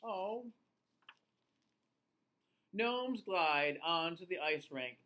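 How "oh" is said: usual speaking voice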